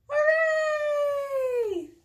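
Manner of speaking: high, loud cheer; shouted hooray. Acted. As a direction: shout it out for the kids. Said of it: "shout hooray".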